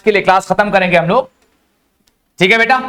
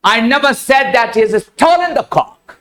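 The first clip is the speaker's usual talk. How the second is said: "stolen" punched and stretched late in the sentence; stress on STOLEN; much slower than usual. Shown as a demonstration. Teacher contrasting stealing with buying, stress on "stolen". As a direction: hit "stolen", as opposed to bought. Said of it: "मैंने कभी ये नहीं कहा कि उसने मुर्गा चुराया है".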